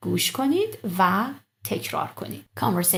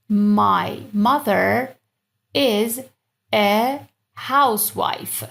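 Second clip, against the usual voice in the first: slow model; 3 dB louder, much slower than usual. Shown as a demonstration. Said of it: "slow translation".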